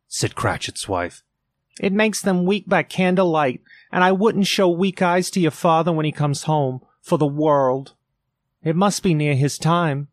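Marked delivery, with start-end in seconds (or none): 1.8-10.1 a mother's brave voice, pitched up; voiced mother. A narrator's reading. Mother to Peter, hiding sore eyes, higher voiced.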